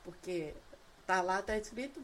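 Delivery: ordinary talk, nothing marked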